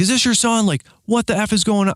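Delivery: high, incredulous retold demand; indignant confrontation. Driver confronts man's dad, high indignant voice. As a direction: re-enact outraged questioning, pitch pushed up.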